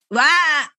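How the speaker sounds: short high mock "wah" yelp; mock wail. Thirty-something celebrating being grown, mock yelp.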